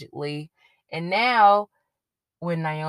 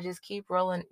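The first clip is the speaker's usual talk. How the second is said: ordinary talk, nothing marked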